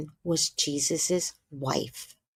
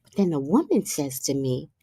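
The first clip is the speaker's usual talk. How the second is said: ordinary talk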